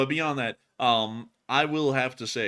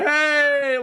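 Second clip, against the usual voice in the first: loud high hey; 10 dB louder than usual. Pretend. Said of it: "We'll be like".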